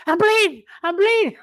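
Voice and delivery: high-pitched imitation of an alarmed cry; mock distress. Ali hit in the car, mimicked as high-pitched distress.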